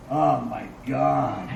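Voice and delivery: very low, slow Oh my God; a slow, deep-voiced Oh my God; deep mock voice. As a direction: keep the deep ad-voice. Continues deep joke voice.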